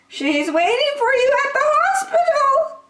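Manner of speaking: high, loud, tearful joy; happy tears for the new baby; joyful granny. Acted. Granny announcing the birth to Franklin, tearful joy.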